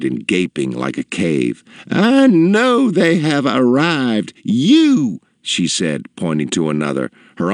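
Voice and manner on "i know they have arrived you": high, slow insistent matron; imperious customer. A narrator's reading. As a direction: insist haughtily, stretching the words high. Customer bullies the shop girls.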